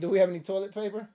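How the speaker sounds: amused, fast repeat through a laugh; giggling disbelief at the prank; laughing echo. Real, not acted. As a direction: laugh it back at the caller. Echoing a prank caller's question.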